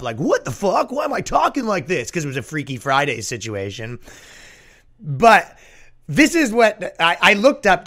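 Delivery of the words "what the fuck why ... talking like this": high-pitched waking-up confusion; high, alarmed mock disbelief; mock bewilderment. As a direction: wake up baffled by your own voice. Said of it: "like, there waking up".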